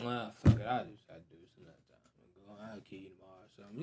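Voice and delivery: low, trailing-off mumble; too drained to bother. Acted. Man with no energy to get mad, weary dismissal. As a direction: drop low and fade away, giving up. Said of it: "I'll be like".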